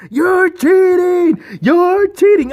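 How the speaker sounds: put-on high, drawn-out shouting in a Russian accent; Lana's Russian accent. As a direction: yell it high like Lana in her Russian accent. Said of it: "I kinda missed that Russian accent".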